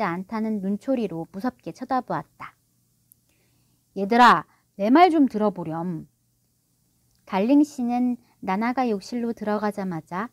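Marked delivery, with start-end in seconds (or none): 4.0-6.1 louder, coaxing father; earnest appeal. A narrator's reading. Father appealing to his disapproving children, earnestly. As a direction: plead mildly for their attention. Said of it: "간절하게 말했다".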